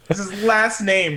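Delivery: usual speaking voice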